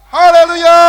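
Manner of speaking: haleluya shouted high and loud; joyful shout. Real, not acted. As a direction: cry haleluya out with the whole church. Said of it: "Coba tahan yang panjang". (teriakan haleluya yang tinggi dan keras)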